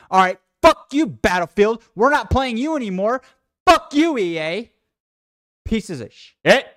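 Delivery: furious yelling at the game, cursing; real rage-quit shout at Battlefield; angry shouting. Real, not acted. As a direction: blow up at the broken game, really loud. Raging at game crash.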